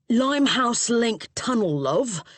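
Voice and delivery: East End London cabbie; Cockney accent. A narrator's reading. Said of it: "heavy Cockney accent".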